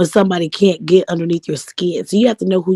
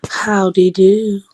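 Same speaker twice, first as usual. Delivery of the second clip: slow breathy sultry greeting; 3 dB louder, much slower than usual. Real, not acted. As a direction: purr it out slowly. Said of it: "I need a sultry voice like that".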